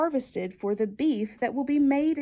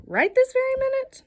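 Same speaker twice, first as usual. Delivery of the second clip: high, astonished rising question; amazed question; 13 semitones higher, 6 dB louder than usual. Acted. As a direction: act amazed at the request. Incredulous echo of child's wish.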